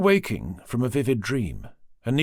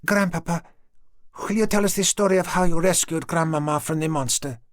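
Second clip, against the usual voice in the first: put-on higher, pleading little girl asking for her favourite story; eager little girl; 6 semitones higher than usual. Granddaughter begs grandpapa for the monster story, high eager child voice. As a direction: beg grandpapa like an excited child.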